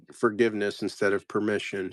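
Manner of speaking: ordinary delivery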